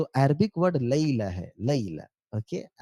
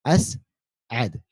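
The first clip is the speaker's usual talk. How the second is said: slow teaching demo; 3 semitones lower, much slower than usual. Shown as a demonstration. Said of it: "क्या जवाब है?".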